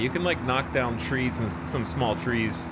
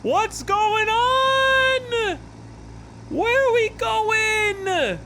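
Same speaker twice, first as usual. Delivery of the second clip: shrieking, very high and loud; panicked squeal of disbelief; high-pitched panic; 4 dB louder, slower than usual. Real, not acted. Flung into space, panicking.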